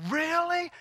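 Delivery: high-pitched wondering question; retold amazement. Woman hearing about salvation on the street, amazed. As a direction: imitate her disbelief up high.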